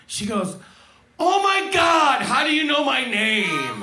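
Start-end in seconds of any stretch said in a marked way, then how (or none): 1.2-3.8 slow, high, loud astonished gasp; shocked gasp. Retold or imitated. Diner stunned the waiter knows her name, loud shocked. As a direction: freak out that a stranger knows you.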